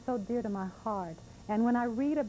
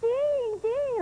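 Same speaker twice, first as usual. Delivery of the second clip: put-on little girl; 7 dB louder than usual. Little girl hitting her arm.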